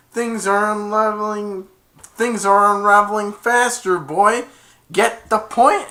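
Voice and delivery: high, loud villain taunt. Retold or imitated. Jafar taunting Aladdin, high loud menace. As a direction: sneer it down at the boy.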